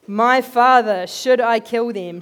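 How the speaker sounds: loud, slow shout to the prophet; the king shouting to Elisha, loud and slow. Retold or imitated. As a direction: bellow it out eagerly. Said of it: "he shouted to Elisha".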